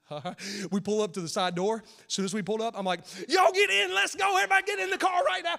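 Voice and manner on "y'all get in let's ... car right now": high, loud hurried commands; shouted, higher and louder, fast; urgent shouting. Retold or imitated. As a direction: hustle everyone into the car. Calling family into car.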